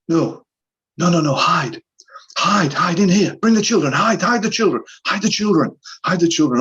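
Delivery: high frantic begging to hide; panicked pleading. Retold or imitated. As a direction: plead in terror, voice climbing. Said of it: "ten times bigger than what I've demonstrated there".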